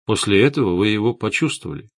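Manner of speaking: plain reading voice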